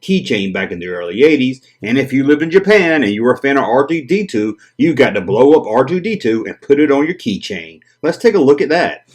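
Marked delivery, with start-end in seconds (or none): none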